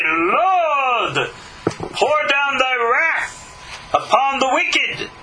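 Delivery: hammed-up, stretched-out invocation; mock imprecatory prayer. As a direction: call down wrath like a doomsday preacher. Acted prayer for enemies' demise.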